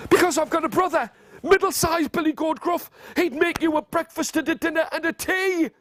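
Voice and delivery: high little goat voice; frightened baby goat. Acted. Baby goat bargaining with troll, high anxious voice. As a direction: plead in a high small voice. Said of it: "Baby Billy Goat Gruff".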